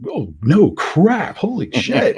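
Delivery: high, startled retold dismay; mock alarm. Player reacting to the sudden sanity check, startled high pitched alarm. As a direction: squeak it out like a caught-off-guard player. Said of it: "And it's like".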